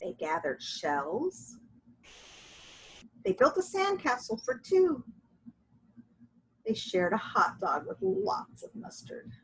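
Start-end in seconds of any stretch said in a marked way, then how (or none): none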